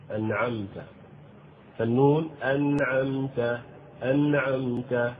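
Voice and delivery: أنعمت with the nun clearly silent; clear sukun. Shown as a demonstration. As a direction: show the sakin nun in أنعمت distinctly. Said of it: "فيجب إظهار السكون".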